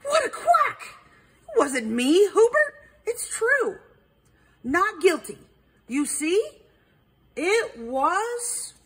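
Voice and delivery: high-pitched, shocked protest; gasped indignation. A narrator's reading. Duck accused of whacking Perry, gasping indignantly. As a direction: gasp it out, high and affronted. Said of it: "Debbie gasped".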